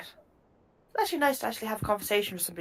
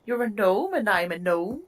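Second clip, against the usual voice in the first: Irish gnome; 2 semitones higher than usual. Acted. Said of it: "the Republic of Ireland accent".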